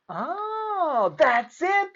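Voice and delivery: high burst of sudden remembering; light up with the answer; excited cry. A narrator's reading. Clark suddenly remembers the rule, crying out excitedly. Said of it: "cried Clark".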